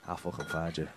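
very low, soft mock threat; mock menace. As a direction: drop it low and quiet, playfully menacing. Mock threat after learning the area.

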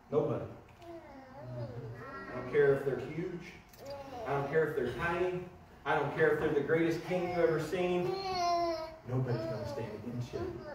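tender voice of God. Acted. God reassuring Joshua, soft and low. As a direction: hush and reassure.

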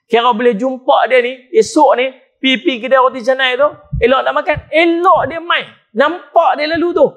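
high, loud impassioned preaching; preaching at full force. Acted. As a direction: drive the story home passionately. Preacher building a forgiveness story.

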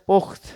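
"পোক্ত" as pokt, stopping on t; no vowel after the t in "পোক্ত"; stopped final consonant. Shown as a demonstration. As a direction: halt at the end of "পোক্ত". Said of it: "এখানে কিন্তু আমরা এসে থেমে যাচ্ছি".

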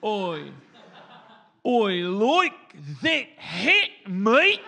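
slow, loud, drawn-out broad Australian; exaggerated Australian accent. Shown as a demonstration. Comic demonstrating his warm-up phrase, overdone Australian accent. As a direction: belt out each Australian vowel. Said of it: "genuinely a true Australian accent".

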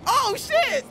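high-pitched startled outburst; real high yelp of surprise. Real, not acted. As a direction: yelp it in sudden alarm. Startled by mishap during gameplay.

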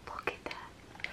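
awed whisper. Real, not acted. Showing viewers her best piece, awed whisper. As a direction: hush it like a secret treasure. Showing result in hushed awe.

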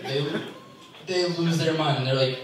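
ordinary delivery

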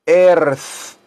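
"earth" with a Spanish R; Spanish-style R before the TH of "earth". Shown as a demonstration. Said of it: "la R así como en español".

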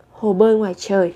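usual speaking voice

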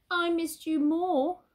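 loving reply. Acted. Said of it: "said Tom".

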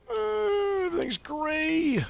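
high, drawn-out dying moan; mock dying voice. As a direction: fade out like a dying man.

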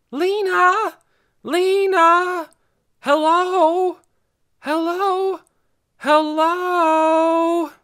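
calling out across hills at full voice; high loud shouts into the dark; shouting. A narrator's reading. Dune calling for Lena across the hills, shouting. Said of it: "He filled his lungs with air and shouted".

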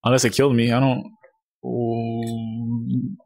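ordinary talk

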